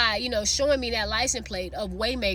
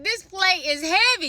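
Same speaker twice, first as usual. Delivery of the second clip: surprised; 8 semitones higher, 4 dB louder than usual. Retold or imitated. Surprised at a full plate.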